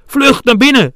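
high, loud shout; urgent call. A narrator's reading. Pipi hurries friends into the tree hollow, shouting. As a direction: call them inside at full urgency. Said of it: "riep Pipi".

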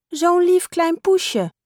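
put-on sweet talk to a baby kitten; high child voice, doting; little girl cooing. A narrator's reading. Girl coos over the kitten.

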